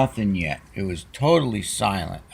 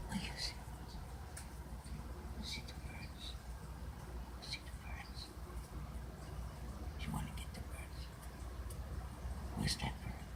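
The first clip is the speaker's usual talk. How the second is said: soft breathy whisper, barely voiced; hushed whisper; 23 dB quieter, faster than usual. Real, not acted. Whispering to her cat watching birds, soft whisper. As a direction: whisper so you don't scare the birds.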